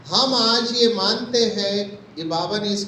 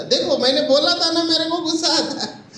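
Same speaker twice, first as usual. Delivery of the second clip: higher, faster half-acted flare of temper; mock angry outburst; 6 semitones higher, faster than usual. Short-tempered brother snapping at the speaker, half-acted, higher and fast. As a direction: snap back like a man caught losing his cool. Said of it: "उसने गुस्सा".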